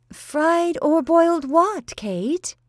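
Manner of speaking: high, slow, puzzled question; a confused lady questioning her cook; bewildered. Acted. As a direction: ask it baffled and high. Bewildered mistress asks cook.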